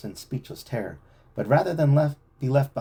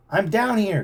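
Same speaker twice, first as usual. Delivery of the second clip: louder, higher call; 10 semitones higher, 5 dB louder than usual. A narrator's reading. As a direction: shout up from the hole. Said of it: "called Joe".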